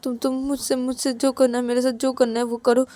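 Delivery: high, stammering eager pleading; desperate insistence. Acted. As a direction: promise anxiously, stumbling over words. Ravi insists he can do it. (हड़बड़ाकर ऊँचे सुर में भरोसा दिलाना)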